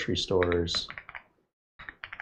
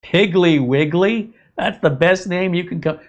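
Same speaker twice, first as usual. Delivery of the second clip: mock disbelief; 9 semitones higher, 6 dB louder than usual. Real, not acted. Scoffing at a Southern grocery name, high incredulous. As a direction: savour the silly name in disbelief. Mocking an unfamiliar store name.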